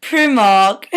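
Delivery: Primark with a clipped British vowel; bad British Primark. Shown as a demonstration. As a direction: botch Primark in a British accent. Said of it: "when I was trying to say".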